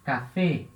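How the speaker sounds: café said with a closed final é; café, last syllable a clear closed é; French café. Shown as a demonstration. Teacher models café to show the acute accent. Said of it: "Acento agudo".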